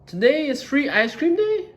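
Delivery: high, loud excited question; hopeful Gerald. Acted. Gerald asking Piggy hopefully, high and loud. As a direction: ask it as if ice cream waits. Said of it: "said Gerald".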